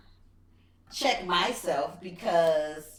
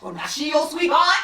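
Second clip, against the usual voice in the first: loud, higher, hammed-up challenge; jealous girlfriend calling him out for laughs; mock jealous outrage; 7 semitones higher, 7 dB louder than usual. Girlfriend challenging his sweetheart talk, mock outraged. Acting out her jealous former self.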